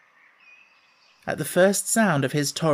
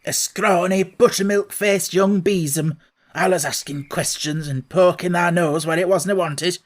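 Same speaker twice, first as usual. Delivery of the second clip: put-on cross Yorkshire old man, scolding; a cranky old gardener telling off a nosy girl; gruff old gardener Ben; 3 semitones higher, 4 dB louder than usual. Old gardener scolding Mary from the wall, cross dialect voice.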